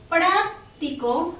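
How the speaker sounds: "práctico" slowly with PRÁC hit, then a gap; stress on first syllable. Shown as a demonstration. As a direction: punch PRÁC, then let "tico" follow. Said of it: "en donde lleva tilde". (práctico cargando la voz en PRÁC, pausado)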